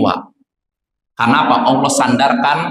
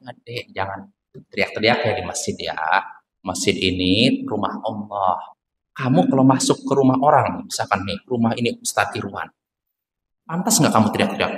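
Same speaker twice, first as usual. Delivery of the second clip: put-on softer, kind teacher voice talking to a young kid; gentle advice to child; 3 semitones lower, 6 dB quieter than usual. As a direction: coax the child softly, no scolding. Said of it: "nasihati baik-baik". (menasihati anak dengan lembut)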